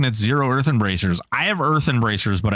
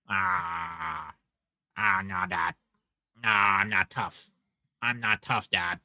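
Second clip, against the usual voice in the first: lower, soft pitiful pup voice; dog's voice; 5 semitones lower, 8 dB quieter, slower than usual. Pretend. Streamer voicing his dog answering dad, lower soft pitiful voice. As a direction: speak for the sad little dog.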